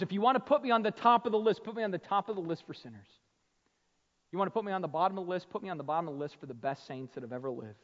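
quick, loud, rushed pulpit voice; rapid emphatic sermon delivery; fast preaching. Acted. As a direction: rattle it off with fire.

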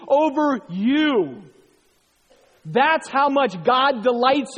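loud, high, slowing preaching; full-force preaching. Real, not acted. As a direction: preach it out with joy.

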